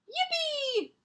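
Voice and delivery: a high, squealing cry of joy; gleeful shout. Acted. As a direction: yelp it like a delighted little fox. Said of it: "he shouted with glee".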